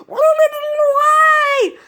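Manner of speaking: very high, loud cat wail; yowling cat. Retold or imitated. Cat yowling after water in its mouth, very high yowl. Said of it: "he was like".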